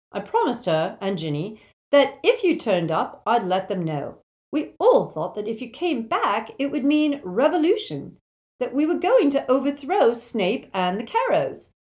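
loud, high, fervent explanation; rally them, voice climbing on the big words; Neville, eager and rousing. Acted.

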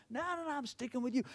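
fast, high, soft acted protest; insistent refusal. Loyal servant refusing to be left behind, insistent. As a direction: brush him off in a quick, high voice. Elisha refusing to leave Elijah.

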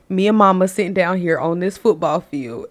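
ordinary talk, nothing marked

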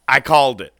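loud gloating I called it; proud yelling boast; triumphant shout. Real, not acted. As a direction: crow it out loud. Gloating about a prediction.

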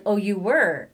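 loud, taken-aback reaction; acted surprise. Host imitating the man who acted surprised, with loud mock surprise. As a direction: imitate someone caught by surprise. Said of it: "the guy acted surprised".

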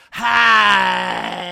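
very high, loud, long-held "hi"; hammed-up shouted greeting. Imitating a contestant making her entrance, high drawn-out shout. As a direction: squeal out one long, overblown greeting.

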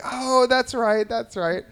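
high, slow, sing-song remembering; sweet worker voice. Retold or imitated. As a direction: imitate a young employee humouring a child. Worker suddenly recalls meeting.

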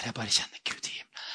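whispered, fast, hushed in awe; awed whisper. Retold or imitated. Pastor on the train, whispering in awe of God. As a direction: breathe it like a prayer.

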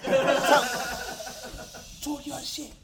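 high, laughing hype; hype egging on. Real, not acted. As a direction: egg him on, laughing.

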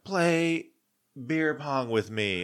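high, slurry tipsy plea; drunk girlfriend. Retold or imitated. Drunk girlfriend at a party demanding beer pong, high voice. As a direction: mimic her drunk and pushy. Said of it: "she's like".

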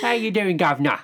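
high, loud mock-British greeting; amped-up British accent. Joining in the friend's greeting, amped-up British accent. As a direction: ham it up as a cheery British geezer. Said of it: "she amps up the British".

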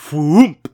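loud punchy whoomp; whoomp sound effect. Acted. Reader voices the cone hand shooting out, loud punch. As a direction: fire it out like a cannon.

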